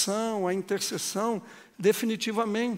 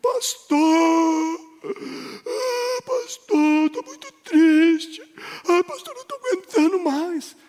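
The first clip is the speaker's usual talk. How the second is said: higher, whiny imitation of a tearful churchgoer pleading; imitando fiel chorosa; 8 semitones higher, 5 dB louder, slower than usual. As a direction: mimic a sobbing woman begging her pastor. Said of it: "se eu tento imitar ou não". (imitação chorosa e aguda de uma fiel)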